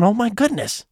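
high, appalled exclamation; retold dismay. Narrator reacts to Joanne's cursing, appalled. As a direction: let the pitch jump in disbelief.

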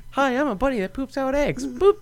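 high, squeaky cartoon bunny; cutesy bunny voice. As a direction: play a silly cartoon bunny. Voicing the Easter bunny.

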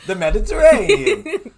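high, loud mock Mediterranean accent; vague Mediterranean accent. Answering what country in a high, loud mock Mediterranean accent. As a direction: belt out a silly Mediterranean accent. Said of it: "That's actually how all Atlanteans sound".

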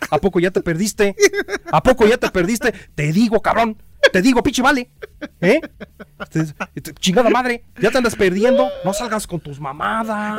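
loud, high scolding in Guanajuato accent. Shown as a demonstration. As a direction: holler it like an older Guanajuato man telling someone off. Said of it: "¿Cómo hablan los de Guanajuato". (regaño a gritos con acento de Guanajuato)

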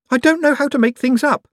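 anxious, insisting plea; high fretful Owlswick. A narrator's reading.

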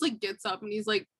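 ordinary delivery

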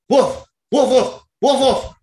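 high, loud woofing like a dog; drunk barking. Retold or imitated. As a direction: bark out the woofs like a drunk dog. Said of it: "he just starts going".

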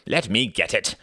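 mildly impatient interjection; a touch of brusque impatience. A narrator's reading. Quentin reaching past Ruth for the door, impatient. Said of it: "said Quentin impatiently".

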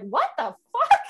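retold disbelief at home; shocked. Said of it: "And I was like".

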